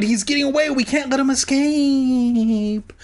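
frantic high-pitched shout. Acted. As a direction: wail it urgently, stretching the last word. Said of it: "I shouted".

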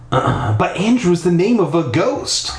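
protesting. Acted. Stephanie objecting to the boy's claim, protesting. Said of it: "Stephanie protested".

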